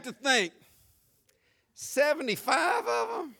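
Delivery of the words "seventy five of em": incredulous listener, hammed up; mock disbelief. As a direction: question it as if you can't believe it.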